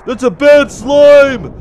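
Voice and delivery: slow, high, loud wail; drawn-out panicked cry; alarmed shout. Real, not acted. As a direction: stretch the warning into a shout. Repeats it louder, drawn out.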